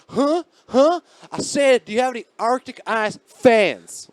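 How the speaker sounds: high, loud mocking Southern tweaker; a guy mocking the cashier, high and loud; mocking imitation. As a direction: mimic her "huh" back at her, sneering. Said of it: "he mocks her".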